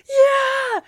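very high, loud imitated fan scream; yelling back. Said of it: "I was yelling with strangers".

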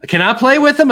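put-on high, eager little-kid ask; high-pitched child voice asking permission; imitating the girls. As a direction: play the excited daughter begging to play. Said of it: "my girls looked at those and said".

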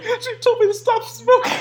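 high mock excuse through laughter; laughing, high-pitched imitation. Imagines friend's excuse, mocking.

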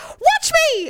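high-pitched theatrical defiance; defiant mock yell. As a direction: re-enact a dare flung back in a quarrel. Retold quarrel, defiant comeback.

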